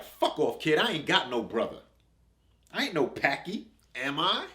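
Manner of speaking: angry rejection. Acted. Refusing the kid's claim, a loud angry refusal. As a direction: shove him off with anger.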